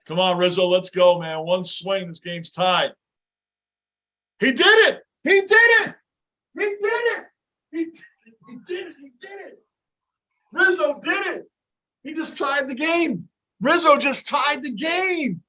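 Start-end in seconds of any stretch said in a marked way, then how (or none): 4.4-15.4 high, loud triumphant yelling; ecstatic shouting. Real, not acted. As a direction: scream with joy at the TV. Fan celebrates tying hit.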